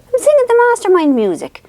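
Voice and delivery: defensive retort. Retold or imitated. To her mother, insisting she's singing the theme, high and indignant. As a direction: protest it like a wounded child.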